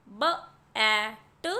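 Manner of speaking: म ए न, each sound split apart and slow; sounding out letters. Shown as a demonstration. Teacher sounding out a word for children, slow and split. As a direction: stretch म ए न into separate sounds. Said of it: "तो यहाँ पर क एट".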